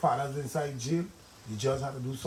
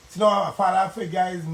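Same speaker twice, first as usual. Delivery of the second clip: loud emphatic; 5 semitones higher, 7 dB louder than usual. Real, not acted. Pleading about absent fathers.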